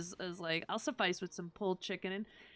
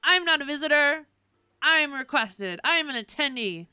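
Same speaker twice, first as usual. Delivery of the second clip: loud, raised-pitch play arguing; mock indignant protest; 5 semitones higher, 9 dB louder than usual. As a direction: insist loudly on being let in. Player argues back at the guard.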